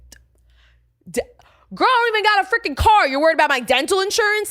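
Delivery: high, loud and fast, stammering then blurting out; sputter, then fire back in disbelief; incredulous mock retort. Answering a friend about dental insurance, loud and fast disbelief.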